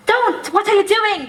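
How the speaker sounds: usual speaking voice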